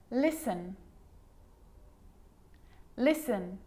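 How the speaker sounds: "Listen" as LISS-en, no T; careful, separate models of "Listen"; silent T. Shown as a demonstration. Teacher showing the sneaky T, careful repeated model. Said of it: "it can also be silent".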